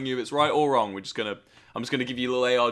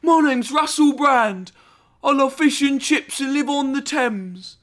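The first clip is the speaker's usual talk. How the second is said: put-on American-style British accent; 11 semitones higher, 6 dB louder, slower than usual. Streamer parodying the American idea of a British accent. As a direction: ham up the tourist idea of a Brit, slow and loud. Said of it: "the American idea of the British accent".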